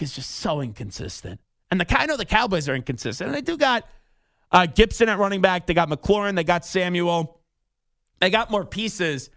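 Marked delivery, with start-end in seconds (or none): none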